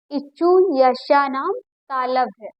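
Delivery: इचु यशानाम तालव्य said slowly and clearly; slow recitation. Shown as a demonstration. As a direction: spell out the sutra slowly like a teacher. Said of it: "क्या बोलेंगे?". (धीमा, स्पष्ट सूत्र-पाठ)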